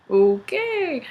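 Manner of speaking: high, soft, drawn-out okay; weirded-out okay. Acted. Family reacting to a strange kid, high dubious okay. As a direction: stretch it out high, humoring him.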